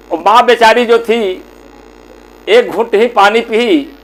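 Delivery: ordinary delivery, nothing marked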